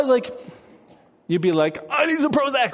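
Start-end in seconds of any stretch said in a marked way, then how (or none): 1.9-2.7 high-pitched frazzled plea; mock panic. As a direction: blurt it out, comically rattled. Imagined panicked reaction at dinner.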